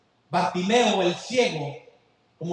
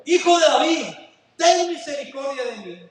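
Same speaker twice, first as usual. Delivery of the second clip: higher, louder cry for mercy; clamoring louder; 6 semitones higher than usual. Acted. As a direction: shout over the crowd. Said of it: "él clamaba mucho más". (Bartimeo clama más fuerte)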